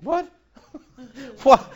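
real laugh through the word; laughing disbelief. Real, not acted. Preacher laughing at the silly union question. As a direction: chuckle the what out.

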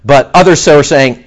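normal talking voice

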